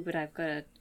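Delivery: ordinary talk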